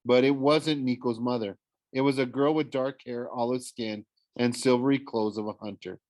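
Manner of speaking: ordinary delivery, nothing marked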